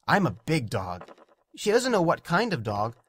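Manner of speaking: usual speaking voice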